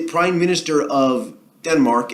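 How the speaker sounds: ordinary talk, nothing marked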